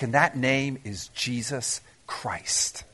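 hushed, slow, reverent whisper. Real, not acted. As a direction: breathe the name softly, slowly. Whispered climax of the point.

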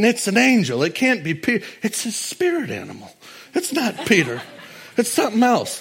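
high, laughing denial; stubborn disbelief. Retold or imitated. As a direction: insist it can't be him. Friends voiced refusing to believe.

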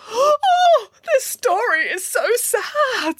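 high, drawn-out hammed-up wail; gasp, then wail it sorrowfully; tearful wail. A narrator's reading. Boris upset by the giant's death, high wail. Child listener upset by story.

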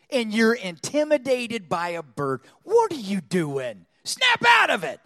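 slow, loud rebuke building to a shout; scolding himself. Retold or imitated. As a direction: shake yourself awake with a shouted order. Scolding himself in retold thought.

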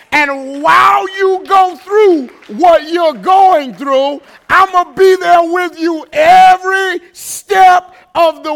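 loud, rising sermon climax, voice straining high; full-throated Sunday preaching, loud and soaring; preaching at full force. Acted. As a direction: preach it out to the whole church, building to a cry. Pastor builds to full preaching.

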